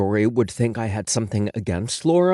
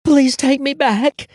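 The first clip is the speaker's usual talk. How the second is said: high, breathless plea to her hairdresser; 14 semitones higher, 5 dB louder, slower than usual. A narrator's reading. Ex-client begging Josh to take her back, breathless. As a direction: gasp his name out, pleading. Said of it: "she said breathlessly".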